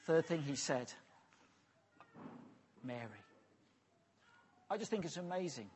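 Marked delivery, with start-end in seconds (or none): 2.8-3.2 low, soft and tender; hushed, personal call of a name; tender naming. Acted. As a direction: say her name softly, like a gentle call. Jesus calls Mary by name.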